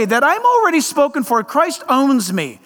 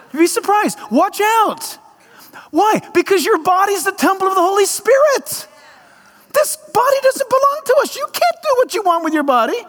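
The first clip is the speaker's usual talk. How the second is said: high-pitched, urgent exhortation; impassioned preaching; 7 semitones higher than usual. Real, not acted. Preacher warns church the body is God's temple, high and insistent.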